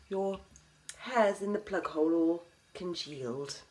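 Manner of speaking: normal talking voice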